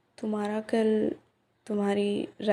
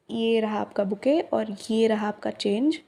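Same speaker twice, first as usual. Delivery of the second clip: ordinary delivery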